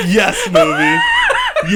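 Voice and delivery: very high, drawn out, laughing shout; laughing squeal of delight; ecstatic cheer. Retold or imitated. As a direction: cheer at the screen like a thrilled fan. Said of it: "I was like".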